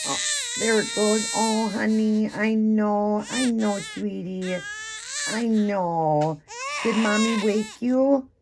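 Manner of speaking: pretend-comforting a doll, high and tender-sounding at first; soothing a baby. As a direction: coo to the crying baby doll like a mom. Mom soothes crying reborn doll.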